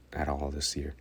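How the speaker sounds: ordinary delivery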